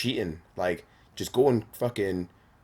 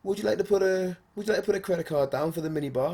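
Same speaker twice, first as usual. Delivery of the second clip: high, courteous hotel-desk voice; polite receptionist; 11 semitones higher, faster than usual. Retold or imitated. Hotel guy asking a guest for a card, politely. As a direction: imitate a very proper receptionist. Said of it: "So he goes".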